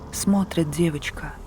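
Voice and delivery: ordinary delivery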